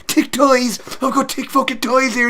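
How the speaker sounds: high, loud Irish caricature; McGregor. Retold or imitated. Mocking a fighter's act, loud high Irish impersonation. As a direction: bellow like a coked-up Irish blowhard. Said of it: "he's, like, doing his whole, like, thing".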